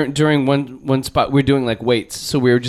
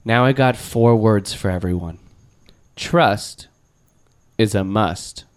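a hint of a sincere speech; 4 semitones lower than usual. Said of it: "he did this entire speech".

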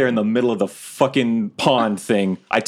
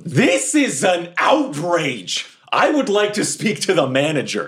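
making a scene; 6 semitones higher than usual. Acted. Customer making a scene at the guards. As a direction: demand the manager, outraged. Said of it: "I start making a scene".

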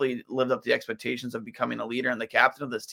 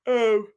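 a big yawn; 10 semitones higher, 5 dB louder than usual. Real, not acted. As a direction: yawn through the word. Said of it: "excuse me for the yawn".

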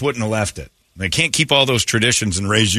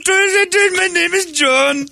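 put-on high, slow Indian accent on "My name is John"; drawn-out Indian accent, mocking the new name; 3 dB louder, much slower than usual. Guy with Indian accent claims the name John, slow and high. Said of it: "With the accent".

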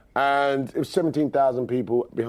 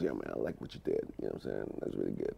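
much lower, much softer near murmur; 15 dB quieter than usual. Retold or imitated. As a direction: drop way down and speak barely above a breath. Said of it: "he's really softly spoken".

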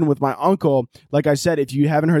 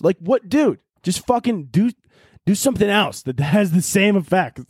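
ordinary talk

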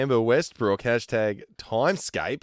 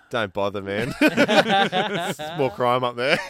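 laughing through quote; much slower than usual. Retold or imitated. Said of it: "And he goes".

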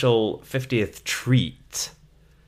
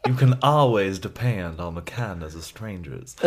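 put-on Southern belle accent; 4 semitones lower than usual. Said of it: "What's that from?".

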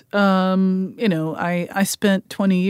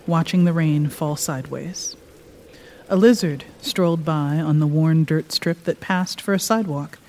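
calm, measured reading aloud; story narration. A narrator's reading. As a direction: read it like a storyteller. Author reads her story aloud.

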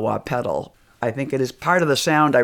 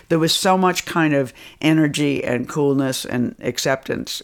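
ordinary talk, nothing marked

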